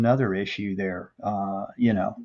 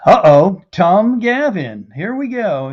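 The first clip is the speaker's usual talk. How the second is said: delighted, high and loud greeting; excited; 9 semitones higher, 7 dB louder than usual. Real, not acted. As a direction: call out the name with glee. New viewer comment pops up.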